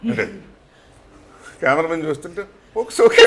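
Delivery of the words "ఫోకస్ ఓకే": loud, laughing mock cameraman; a hammed-up cameraman shouting focus okay; mimicking cameraman. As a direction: call it out like the eager cameraman, laughing. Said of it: "అంటాడు ఆయన".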